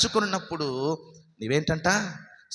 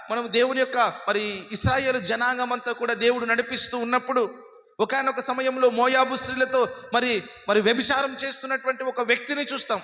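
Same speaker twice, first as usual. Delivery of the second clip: high, sustained sermon pitch; rapid-fire preacher on a high held tone; full-force preaching; 10 semitones higher than usual. Acted. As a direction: proclaim it urgently to the congregation.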